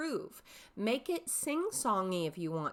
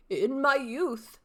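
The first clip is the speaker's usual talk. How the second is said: put-on slow, deliberate old man; old father; 4 semitones higher, 7 dB louder, slower than usual. Shown as a demonstration. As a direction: savour the words like a wise old father. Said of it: "three distinct character voices".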